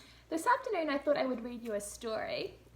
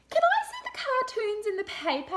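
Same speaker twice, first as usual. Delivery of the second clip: put-on much higher, louder girl asking; child's voice; 11 semitones higher, 9 dB louder than usual. Laura pestering mum in the bath, high child's voice. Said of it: "asked Laura".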